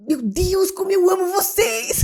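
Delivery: overwhelmed with love. Retold or imitated. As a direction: burst out in overflowing love. (exclamação emocionada, aguda e lenta)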